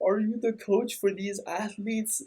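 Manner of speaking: a hint of teary complaint to the coach; mock tearful question. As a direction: imitate a crying man asking for help. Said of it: "He's, like, in tears".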